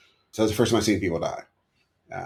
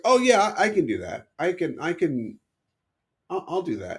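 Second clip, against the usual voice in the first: eager yes; 10 semitones higher than usual. Retold or imitated. Eager young self agreeing.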